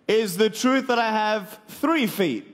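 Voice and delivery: ordinary delivery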